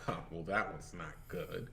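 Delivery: low, quiet letdown; deflated aside. Retold or imitated. Comic onstage after silence, quiet deflated aside. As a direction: drop it low and soft after a flop.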